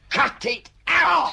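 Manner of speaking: high, loud, slow yell to break it up; shout to stop. Acted. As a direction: bellow at them to stop fighting. Breaking up a scuffle.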